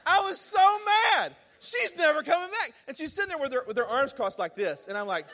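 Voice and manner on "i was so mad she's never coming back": high, loud exasperated outburst; mad, retold. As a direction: relive the fury, loud and high.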